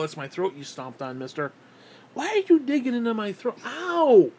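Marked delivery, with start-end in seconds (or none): 2.1-4.3 much higher, louder cry of pain; real pained yelp. Real, not acted. Owner crying out as the dog digs into his throat.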